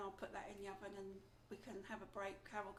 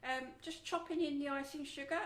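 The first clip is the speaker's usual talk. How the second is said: speaking up, a bit louder; 4 semitones higher, 6 dB louder than usual. Real, not acted. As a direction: project to the back. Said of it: "I'll speak louder".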